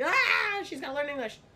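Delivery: loud, high mock-panicked yelp, then easing; freaking out. Parents worried about their child starting school, mock panicked outburst. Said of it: "they freaked out".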